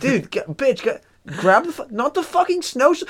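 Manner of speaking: high, squeaky bossing bro voice; high mock bro yelling at his girlfriend; bro killer voice. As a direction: boss her around like a frantic bro.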